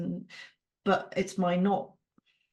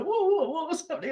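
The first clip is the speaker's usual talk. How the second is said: startled husband; 8 semitones higher, faster than usual. Retold or imitated. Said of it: "And he's like".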